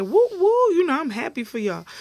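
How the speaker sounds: high, loud whooping cheer; imitated celebrating. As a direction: whoop like a delighted guest. Acting out Pam celebrating.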